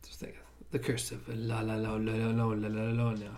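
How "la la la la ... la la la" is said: low monotone run of "La La La"; string out "La La La" on one low note; playful garbled name. Pretend. Joking about a film curse, playfully garbled name.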